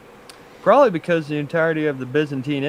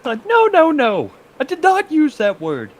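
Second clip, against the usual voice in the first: put-on shrill-ish offended old poet, much higher and louder; indignant high voice; 11 semitones higher, 7 dB louder than usual. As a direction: play a scandalised ancient poet, high and loud. Playing offended Homer.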